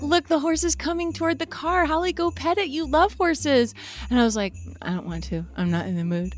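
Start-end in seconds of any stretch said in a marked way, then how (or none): 0.0-3.7 higher eager parent voice; coaxing parents. Retold or imitated. 4.8-6.3 low, softer sulky mumble; a grumpy twelve-year-old refusing, lower and softer; sulky refusal. Retold or imitated. Sulking child.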